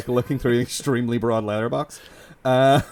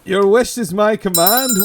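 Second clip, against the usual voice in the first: higher, louder hammed-up genie proclamation; ironic genie; 6 semitones higher, 5 dB louder than usual. Host granting co-host's wish as a new djinn, hammed-up ironic genie. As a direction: grant the wish with spooky theatrical flourish. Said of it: "granting it in a terribly ironic way".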